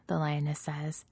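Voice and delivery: ordinary delivery, nothing marked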